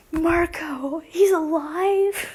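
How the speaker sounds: high, drawn-out cry of amazement; excited gasped exclamation. Retold or imitated. Reader reacting to finding a Marco story, excited gasp. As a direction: gasp it out as if someone is back from the dead. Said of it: "and I went".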